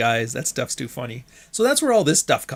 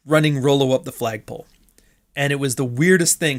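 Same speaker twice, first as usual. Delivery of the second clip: ordinary talk, nothing marked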